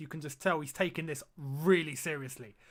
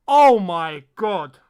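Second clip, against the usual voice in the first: louder, slow, drawn-out exclamation; loud alarmed gasp; 15 dB louder, much slower than usual. Real, not acted. Streamer watches the replay of Kepa's error, loud drawn-out gasp.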